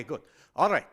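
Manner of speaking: ordinary talk, nothing marked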